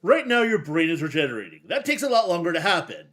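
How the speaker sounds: booming, gleeful brute; loud Hulk voice. Acted. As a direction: bellow it like a smug giant. Hulk smashing Wolverine.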